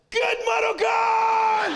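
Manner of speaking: high, long-drawn shout; imitated yelled insult. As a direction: yell it out slowly, stretching every syllable high. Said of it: "schreeuwt hij in mijn auto".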